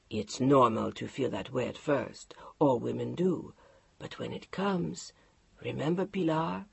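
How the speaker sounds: low, hushed, half-whispered reassurance; calm husband. Acted. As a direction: soothe her as if it's settled. Mark soothing his wife.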